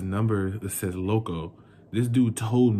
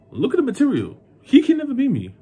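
high, loud burst of disbelief; indignant boast; 8 dB louder, faster than usual. Real, not acted. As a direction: voice leaps way up, insisting.

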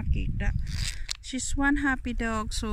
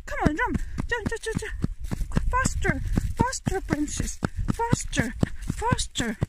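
high, repeated calls of encouragement; excited high-pitched pet talk; urging a dog on; 7 semitones higher than usual. Real, not acted. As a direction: cheer the running dog on.

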